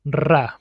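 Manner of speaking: "ra" with a rolled R; isolated Spanish "ra" starting on a trill; multiple R. Shown as a demonstration. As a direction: trill the R hard in the syllable "ra". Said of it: "always pronounced as multiple R".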